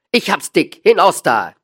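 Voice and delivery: loud, high burst of temper; angry shout. A narrator's reading. Dealer driving the small man away, shouting angrily. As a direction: throw him out with a shout.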